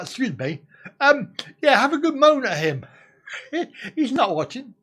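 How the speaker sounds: ordinary delivery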